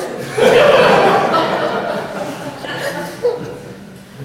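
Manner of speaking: loud high laughter, rolling down; real burst of laughter. Real, not acted. Lecturer to audience, laughing loudly at the student joke.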